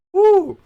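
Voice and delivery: loud high woo. Real, not acted. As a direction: let out a big high woo. Reacting to spicy heat.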